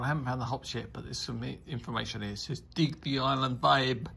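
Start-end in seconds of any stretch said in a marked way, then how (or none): 2.7-4.1 loud put-on Caribbean accent; West Indian accent. Beer reviewer reading the can's blurb, in a put-on Caribbean accent. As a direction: read it out in a mock West Indian lilt. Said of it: "West Indian, Caribbean, uh, accent there".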